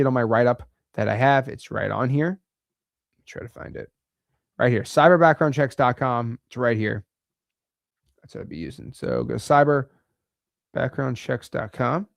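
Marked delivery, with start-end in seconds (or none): 3.3-3.9 whispered to himself; muttered aside. Real, not acted. Presenter searching his page, whispered aside. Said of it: "muttering to himself at screen". 8.2-8.9 whispered to himself; muttered aside. Real, not acted. Presenter searching his page, whispered aside. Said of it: "aside while searching page".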